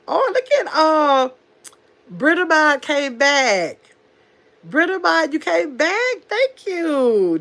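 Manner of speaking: high, slow excited welcome; light up at a friend coming back; delighted greeting. Real, not acted. Host welcomes back a moderator, high and delighted.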